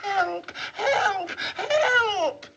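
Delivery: hammed-up little girl screaming; mock screams for help. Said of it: "she screams".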